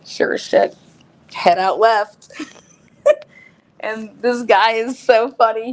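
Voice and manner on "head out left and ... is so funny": ordinary talk, nothing marked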